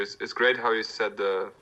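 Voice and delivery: ordinary delivery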